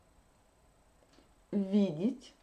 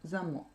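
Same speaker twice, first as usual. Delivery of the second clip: "Замок" accented on "мок", said lightly; "Замок" with end stress, falling tone; stress on second syllable; 4 semitones lower, 5 dB quieter than usual. Shown as a demonstration. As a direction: put the weight on the last vowel of "Замок". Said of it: "l'accent tombe sur la deuxième syllabe".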